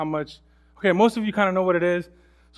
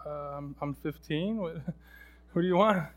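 put-on awkward teen; 6 dB quieter than usual. Voicing awkward teenager.

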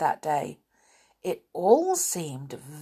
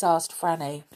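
ordinary delivery

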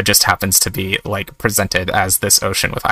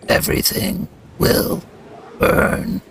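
a high, menacing whisper; villain whisper; 9 semitones higher, 3 dB quieter, slower than usual. Acted. Noel threatening the world, in a high menacing whisper. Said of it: "and says".

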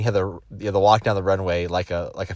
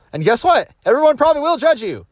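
emphatic punchline; 5 dB louder than usual. Real, not acted. Motivational punchline to viewers.

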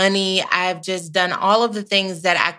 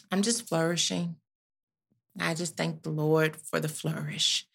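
hushed pious murmur; 8 dB quieter than usual. Real, not acted.

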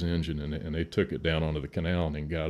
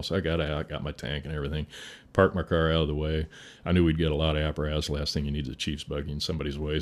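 ordinary talk

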